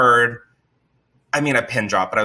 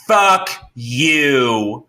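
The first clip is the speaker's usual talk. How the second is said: mild mock yell, drawn out; stretched-out, half-voiced holler; retold scream; 3 dB louder than usual. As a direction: imitate a screamer without full volume. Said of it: "screaming in front of everyone".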